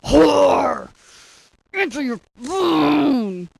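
slow yelled demand, angry; yelling. Shown as a demonstration. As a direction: bark it like an angry voicemail. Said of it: "you gotta say it like I say it".

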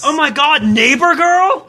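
high, hammed-up thrill; mock excitement. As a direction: overdo the excitement on request, drawing out the words. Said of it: "need a little bit more excitement".

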